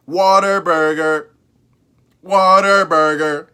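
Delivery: Whataburger drawn out slowly as "Water-burger"; childhood pronunciation. Shown as a demonstration. Showing viewers his childhood way to say the name, slow. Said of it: "That's how I pronounced it".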